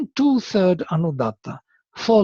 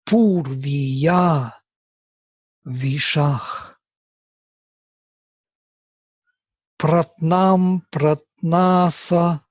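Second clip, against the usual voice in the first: slow, measured Sanskrit recitation; verse recitation; much slower than usual. Shown as a demonstration. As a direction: draw out each syllable, pausing between words.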